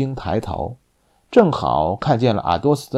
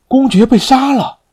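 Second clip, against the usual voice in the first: high, loud shocked cry; shocked shout; 13 semitones higher, 7 dB louder than usual. A narrator's reading. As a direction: cry out in disbelief. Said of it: "异口同声地喊道".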